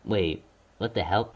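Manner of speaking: ordinary talk, nothing marked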